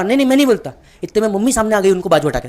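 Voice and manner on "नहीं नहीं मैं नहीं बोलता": higher, louder flustered no; father declining the chair, higher and louder; dad refusing. Retold or imitated. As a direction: brush it off, pitch up. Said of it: "पापा".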